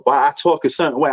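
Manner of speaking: ordinary talk, nothing marked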